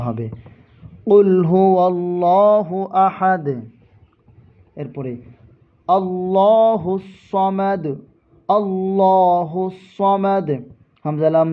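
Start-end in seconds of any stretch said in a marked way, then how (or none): 1.1-3.5 slow, melodic recitation of the verse; Quran recitation. Shown as a demonstration. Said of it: "সাবধান, কোরআন তিলাওয়াত করার সময়". 5.9-10.5 slow, melodic recitation of the verse; Quran recitation. Shown as a demonstration.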